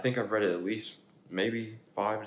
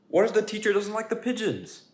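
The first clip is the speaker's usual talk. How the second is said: much higher, fretful pigeon voice; anxious pigeon; 7 semitones higher, 5 dB louder than usual. Acted. Pigeon panics about learning too much, much higher. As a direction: panic about all the stuff.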